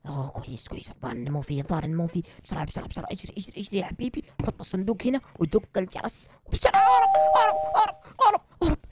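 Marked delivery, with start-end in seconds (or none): 2.4-4.2 hurried whisper. Acted. Sneaking the box back. 6.5-8.8 panicked cry. Acted. Fleeing after ringing the bell.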